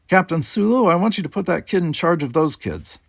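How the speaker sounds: high, loud order to the room; brisk officer handing out orders; commanding captain. A narrator's reading. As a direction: bark it across the stage. Sulu gives an order.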